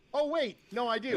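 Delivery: high, sudden fake surprise; mock realization. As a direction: act like it just dawned on you. Joke about life repeating.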